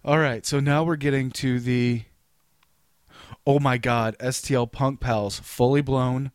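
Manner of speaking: normal talking voice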